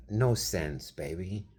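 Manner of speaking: ordinary talk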